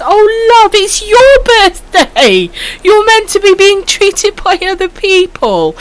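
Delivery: high-pitched, slow, gushing thanks; excited squeal. Real, not acted. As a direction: squeal with delight over the gift. Thanking a viewer for birthday bits.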